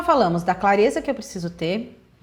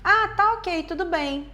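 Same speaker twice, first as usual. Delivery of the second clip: bright, high, relaxed agreement; cheerful and easygoing; 8 semitones higher, slower than usual. Shown as a demonstration. Partner texting back, sounding cheerful and agreeable. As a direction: sound genuinely fine with it. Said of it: "a pessoa pode tá dizendo assim".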